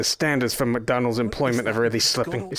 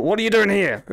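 mock demand; 8 semitones higher, 3 dB louder than usual. Ordering a game character.

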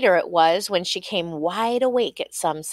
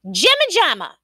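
high, louder burst; shouted; 8 semitones higher, 5 dB louder than usual. A narrator's reading. Queen blurting her third guess, shouting. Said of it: "she shouted".

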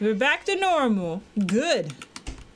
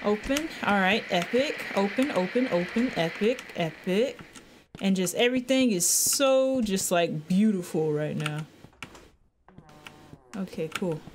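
normal talking voice

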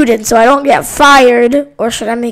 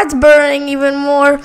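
usual speaking voice

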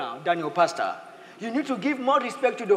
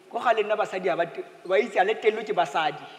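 put-on old way of thinking; 2 semitones lower, much faster than usual. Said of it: "The old way say".

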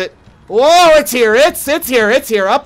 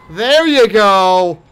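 slow, satisfied; 5 dB louder, much slower than usual. Real, not acted. Killing the monster.

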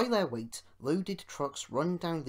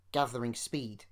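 plain reading voice

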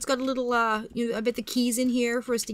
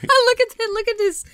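laughing; 12 semitones higher, 6 dB louder than usual. Real, not acted. Laughing at the character's look.

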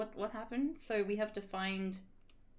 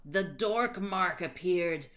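ordinary delivery, nothing marked

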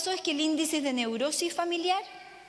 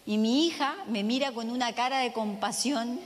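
ordinary talk, nothing marked

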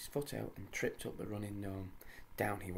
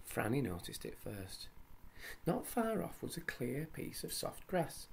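ordinary delivery

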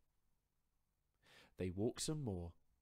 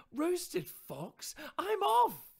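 much higher, louder, alarmed fox voice; panicked fox; 15 dB louder, much slower than usual. Acted. Fox fleeing from the mouse in a high, loud panic. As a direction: fox bolting in fright.

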